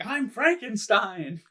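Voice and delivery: slow, high, drawn-out proclamation; imitated proud declaration. Quoting the scientist's big moment, mock proclamation. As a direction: proclaim the name theatrically. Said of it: "whenever he's like".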